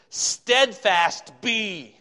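loud, high, drawn-out STEADFAST before BE; stress on STEADFAST. Shown as a demonstration. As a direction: declaim it slowly, leaning hard on STEADFAST. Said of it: "It kind of emphasizes the word steadfast".

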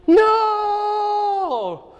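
sudden high yelled "No!". Retold or imitated.

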